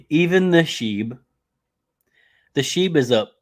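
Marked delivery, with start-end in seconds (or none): 0.1-1.2 slow excited, louder and higher; drawn-out hype call. Real, not acted. Hyping coin to chat.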